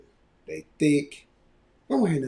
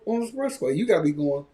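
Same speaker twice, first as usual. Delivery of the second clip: ordinary talk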